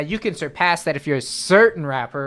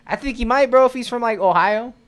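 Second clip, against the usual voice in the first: excited; 7 semitones higher, 5 dB louder than usual. Real, not acted. Excited rising outburst.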